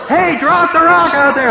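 high loud call from afar. Retold or imitated. The group calling to the drowning newcomer, loud high call. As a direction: shout it out across the water. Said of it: "And they're going".

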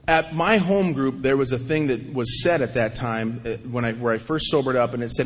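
ordinary delivery

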